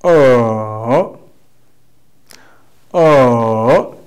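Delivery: "O" dipping low, then rising; third tone. Shown as a demonstration. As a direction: let "O" fall deep and swing back up. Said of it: "You fail and rise up".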